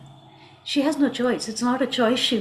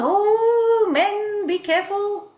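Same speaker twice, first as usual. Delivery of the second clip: high, drawn-out cautioning cry; dramatic warning; 8 semitones higher, 4 dB louder, slower than usual. Acted. Storyteller warns men of a scorned woman, theatrically. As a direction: sound the alarm in a raised voice.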